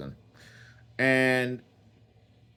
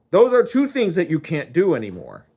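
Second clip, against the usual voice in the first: raised, scolding ban; mad; 5 semitones higher, 4 dB louder, faster than usual. Acted. God laying down rules to the man, angry. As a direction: lay down the rule crossly. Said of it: "he was mad".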